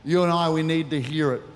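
ordinary talk

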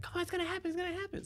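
very high-pitched mock female voice; high, squeaky retold urging; delivery-room lady. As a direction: imitate an urgent woman in a high falsetto. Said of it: "I hear the lady's like".

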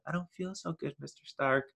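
a faint, frightened Spider-Man giving out; fading Spider-Man. Retold or imitated. Spider-Man fading away quote.

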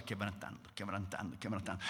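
hushed fading repetition. Acted. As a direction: let the word melt away. Heart breaking, fading away.

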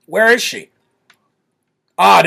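normal talking voice

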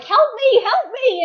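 high, loud panicked cry for help; imitated yelling. Wife acting out husband's plea for help, high yelling. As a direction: call out like a flustered husband. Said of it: "my husband is yelling, like".

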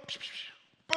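quick whooshing mouth sound effects; voiceless "psh" for each toss; three quick voiceless whooshes; imitated throwing noises. Imitates frogs being thrown.

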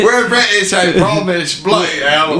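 high, loud mock British; British accent. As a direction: ham up "bloody hell" like a Brit. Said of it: "We're practicing our British".

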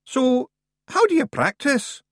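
high, slow, aghast Scottish; appalled little warrior; horrified Feegle. A narrator's reading. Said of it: "asked Rob in horror".

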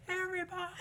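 high call from far off; distant shout. Acted. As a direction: shout it from across the room. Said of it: "And then in the distance".

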